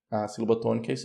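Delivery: normal talking voice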